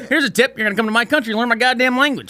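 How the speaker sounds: high, rushed mock lecture; mock rant. Customer giving the driver a tip as advice, mock ranting. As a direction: rattle off a hammed-up telling-off.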